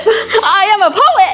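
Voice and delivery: put-on kid poet voice. Kid poet role bit.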